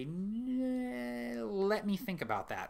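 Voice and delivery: high, long-held opening sound before answering; stalling hesitation. Shown as a demonstration. Dungeon master catching themselves before saying no, stalling.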